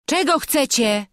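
put-on high, loud, whiny boy's voice; grudging child servant; reluctant boy. A narrator's reading. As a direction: answer unwillingly as a young lad. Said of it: "rzekł chłopak niechętnie".

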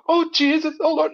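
very high, hammed-up dismay; mock pious exclamation. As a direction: squeal a prim, unlikely oath. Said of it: "You didn't shout and go".

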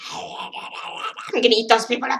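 playful shark threatening the people. Pretend. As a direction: play a greedy cartoon shark.

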